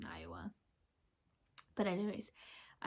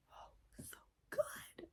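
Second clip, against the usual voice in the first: breathy whisper; 10 dB quieter, slower than usual. Real, not acted. Gushing over TikTok edits.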